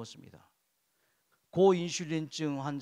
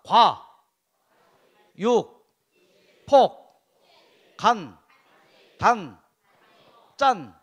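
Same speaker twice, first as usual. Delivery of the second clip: each syllable shouted separately with a pause; loud drilled call-out; 3 semitones higher, 14 dB louder, much slower than usual. Shown as a demonstration. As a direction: call out the mnemonic forcefully, beat by beat. Leads review with attendees, syllable by syllable.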